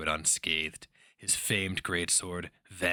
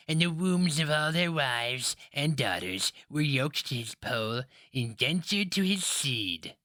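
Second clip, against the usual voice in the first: put-on old woman; 4 semitones higher than usual. A narrator's reading. Old woman telling legend.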